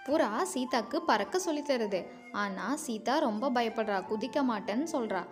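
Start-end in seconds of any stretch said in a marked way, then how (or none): none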